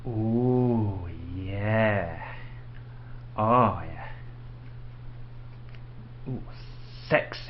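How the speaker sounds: low, soft mock-sultry cooing; mock seductive. Man pretending to admire drawn-on eyebrows, mock seductive. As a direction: coo as if sarcastically turned on.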